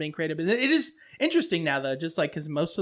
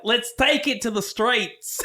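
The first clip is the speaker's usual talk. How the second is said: slow, drawn-out New Zealand attempt; New Zealand accent; 3 semitones higher, slower than usual. Shown as a demonstration. Demonstrating for co-host how New Zealand differs, slow New Zealand accent. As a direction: try an eerie New Zealand twang. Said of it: "New Zealand accent is just a little bit higher pitch".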